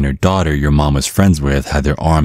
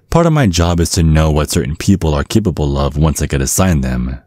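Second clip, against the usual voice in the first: usual speaking voice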